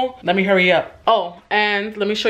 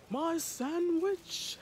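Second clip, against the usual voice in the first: slow, delighted, higher cry; gleeful drawn-out exclamation; excited; 9 semitones higher, 14 dB quieter, much slower than usual. Real, not acted. Excited about her sandwich.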